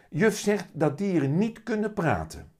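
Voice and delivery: put-on little boy voice. A narrator's reading. Willem tells mum what teacher said, high child voice.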